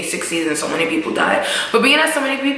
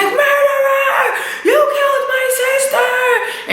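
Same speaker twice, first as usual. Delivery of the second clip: drawn-out high mock shout; imitating a screaming sister; slower than usual. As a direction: yell the accusation slowly and shrilly. And she's crying.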